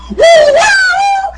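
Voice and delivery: high, loud mocking frat call; mock frat whoop. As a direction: ham up a college frat guy's whoop. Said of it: "When they see a friend".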